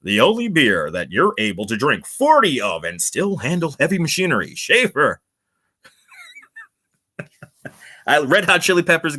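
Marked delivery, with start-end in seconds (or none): 0.0-5.2 slow, higher jingle-style ad read; mock retro radio beer ad; vintage beer commercial. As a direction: croon the old beer slogan. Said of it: "Can I remember a beer".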